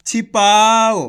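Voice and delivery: loud "छिपाओ" with पा stretched long; syllable-by-syllable reading. Shown as a demonstration. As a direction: sound out "छिपाओ" for learners, holding पा. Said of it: "किस तरीके से ये शब्द पढ़े जा रहे हैं".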